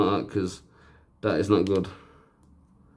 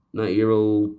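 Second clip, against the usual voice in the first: ordinary delivery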